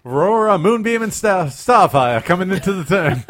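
high, loud excited race call; race announcer. Acted. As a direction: call the final turn with excitement. Mock race commentary.

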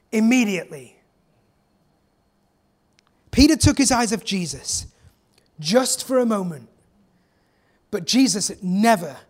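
usual speaking voice

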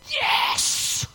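a high, breathy cry of joy; joyful yes. Pretend. Preacher acting out rejoicing when insulted, high and thrilled. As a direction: cheer it out, delighted. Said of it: "you got insulted and you went".

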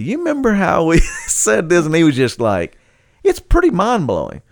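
high and loud, laughing through the quote; laughing excitedly. Retold or imitated. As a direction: giggle while recalling the promise. Excitedly retelling to Chip.

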